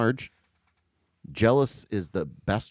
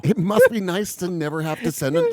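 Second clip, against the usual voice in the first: high, mock-resentful complaint; put-on wounded jealousy; sarcastic envy; 8 semitones higher, 4 dB louder than usual. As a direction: joke bitterly, pitch pushed up. Continues the mock-envious riff.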